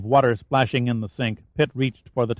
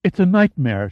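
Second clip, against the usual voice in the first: ordinary delivery, nothing marked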